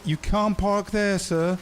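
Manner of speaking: slow, lightly voiced officious parking warning; meme quote. Vlogger quoting the parking meme in a lightly mock voice. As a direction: recite the meme like a mild attendant. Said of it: "You know the meme".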